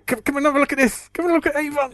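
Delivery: high, fast eager beckoning; excited calling out. Retold or imitated. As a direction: call people in to come and look. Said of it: "You know, you go".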